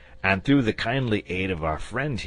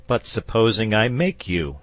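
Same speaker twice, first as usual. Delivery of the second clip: a touch of irritation, pitched up and louder; mildly nettled challenge; rather nettled; 6 semitones higher, 7 dB louder than usual. A narrator's reading. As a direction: George mildly nettled at the dragon. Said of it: "rather nettled".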